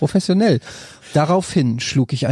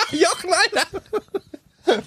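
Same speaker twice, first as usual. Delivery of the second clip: laughing hard; much slower than usual. Real, not acted. Cracking up at partner's voice.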